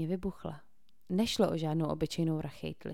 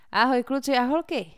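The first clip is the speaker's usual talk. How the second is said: loud, higher upbeat greeting to viewers; youtuber voice; 6 semitones higher, 9 dB louder than usual. A narrator's reading. Cole greets his livestream viewers: loud youtuber hype. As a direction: greet the fans like a vlogger. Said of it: "youtuberským hláskem".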